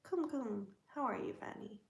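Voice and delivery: ordinary delivery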